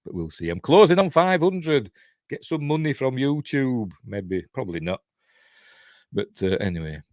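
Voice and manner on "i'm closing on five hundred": ordinary talk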